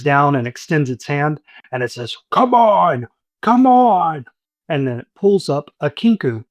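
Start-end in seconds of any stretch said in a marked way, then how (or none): 2.3-4.3 high, urgent monster call; high gruff bugbear beckoning. Acted. Bugbear calling up from sewer.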